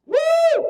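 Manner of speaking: loud, high-pitched whoop; excited whoop. Real, not acted. Speaker fires up the audience, loud excited whoop. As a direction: let out a big rousing woo.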